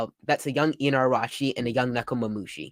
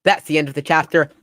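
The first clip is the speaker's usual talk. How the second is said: ordinary talk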